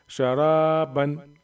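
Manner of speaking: "शराबन" slowly, रा held out; quick ends round a stretched रा in "शराबन"; long रा. Shown as a demonstration. Teacher joining spelled letters, long रा in "शराबन". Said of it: "रा को तो खींचेंगे".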